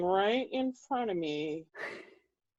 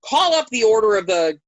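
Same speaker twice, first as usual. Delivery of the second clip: loud, commanding summons; royal command; 2 semitones higher, 8 dB louder than usual. Retold or imitated. King and queen summoning the order, commanding. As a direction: call it out to the hall.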